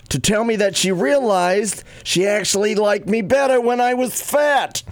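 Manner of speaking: a Reader's Digest story read in mom's New York voice; mom's New York accent. Acted. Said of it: "as my mom would read 'em".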